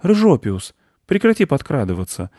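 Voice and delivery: high-pitched reprimand; boy scolding cat. A narrator's reading. Boy stops cat creeping closer, scolding. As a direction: rein the cat in with a high kid voice. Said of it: "одёрнул мальчик рыжего кота".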